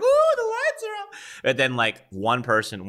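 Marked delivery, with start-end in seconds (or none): 0.0-1.4 very high, drawn-out mock kid squeal; rowdy kids. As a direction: imitate excited children hooting in the dark. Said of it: "everyone would get louder".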